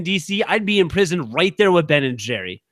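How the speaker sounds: ordinary talk, nothing marked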